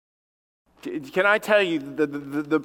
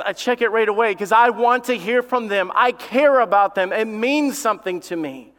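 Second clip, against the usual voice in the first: raised, driving pulpit delivery; impassioned preaching; 5 semitones higher, 5 dB louder than usual. Real, not acted. Preacher on God wanting to hear from us, impassioned preaching. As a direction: press each point home, higher pitched.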